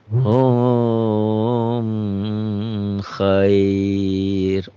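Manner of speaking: "Hum khair" with the mim held and drawn out; wrong reading, digayem. Shown as a demonstration. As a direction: linger on "Hum" before "khair". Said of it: "Padahal salah bacanya ya".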